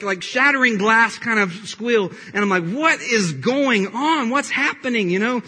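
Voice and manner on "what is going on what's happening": exasperated, higher-pitched alarm; retold bewildered outburst, stretched out; alarmed parent. Parent reacting to screaming child.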